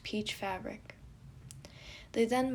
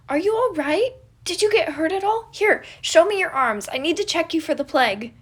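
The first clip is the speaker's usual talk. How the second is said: high, loud, anxious fussing; worried fussing; 9 semitones higher, 15 dB louder than usual. Acted. Patton fussing over hurt Roman, high worried cries. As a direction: fret over him anxiously. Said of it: "knit his brows in concern".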